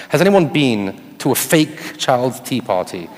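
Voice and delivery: normal talking voice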